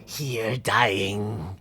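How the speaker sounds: slow unvoiced deathly whisper; eerie whisper. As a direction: breathe it like a ghost. Voicing undead villain whisper.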